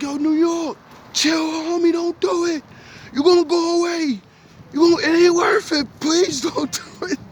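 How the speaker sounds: high, strained, pleading call; high, pleading cry to a friend about to kill a man; urgent pleading. Retold or imitated. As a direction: beg him to stop before he kills. Begging a man to stop.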